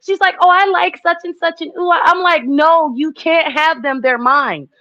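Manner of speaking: put-on childish possessive. Childish possessive quarrel with mom.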